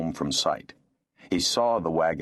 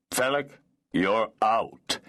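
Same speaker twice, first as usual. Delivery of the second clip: lightly voiced cold, harsh lord; a hint of a cold lord's rebuke; 4 semitones higher than usual. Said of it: "in a cold, harsh voice".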